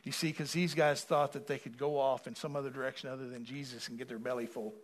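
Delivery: ordinary talk, nothing marked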